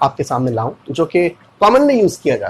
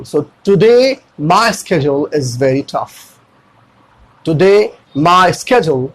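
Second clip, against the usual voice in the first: very slow, each word drawn out; slow demo; much slower than usual. Shown as a demonstration. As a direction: stretch the sentence out slowly for learners. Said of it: "कहीं पे slow करना पड़ता है".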